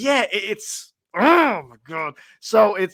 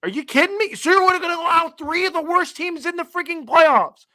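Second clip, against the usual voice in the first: higher, incredulous retelling of the complaint; exasperated retold outburst; 11 semitones higher, 3 dB louder than usual. Retelling his outburst to the league commissioner, pitched-up exasperation. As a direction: replay the outrage at the league rules, pitched up. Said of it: "I'm like".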